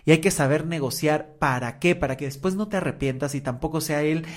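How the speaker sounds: ordinary talk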